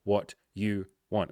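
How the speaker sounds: slow, halting, word by word; real tongue-tied slowdown; struggling to talk. Real, not acted. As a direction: stumble and labour over each word. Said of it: "I'm struggling to talk".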